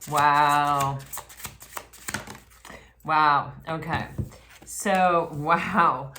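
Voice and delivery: loud, real amazement; stunned wow. Real, not acted. Reader reacting to the tarot cards, real loud astonishment. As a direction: gasp it out, floored by what you see.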